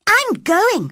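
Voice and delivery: put-on high, loud defiant girl's shout. Mary shouting back at the boy. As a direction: fling it back at him. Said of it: "Mary shouted in reply".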